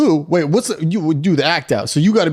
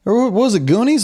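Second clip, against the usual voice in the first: usual speaking voice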